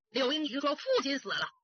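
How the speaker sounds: usual speaking voice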